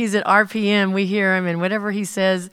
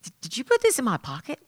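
higher, quick stammered question; retold repeated question; 4 semitones higher, faster than usual. Jay asking Easy about the gift card, higher retold question. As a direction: stumble into it, pitched up and quick. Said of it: "he goes".

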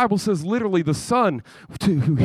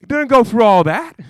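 high, loud, exasperated scolding; mock outraged rant; 6 semitones higher, 8 dB louder than usual. Groom scolding the bride who refused him, loud and exasperated.

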